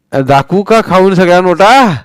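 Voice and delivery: high, loud playful threat; mock threatening old man. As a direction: threaten the boys in jest.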